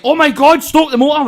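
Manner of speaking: high, loud panicked cry; retold freak-out, shouted high. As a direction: yell at the driver to stop. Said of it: "I'm like".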